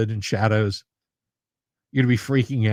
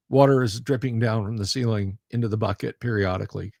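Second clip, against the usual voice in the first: ordinary delivery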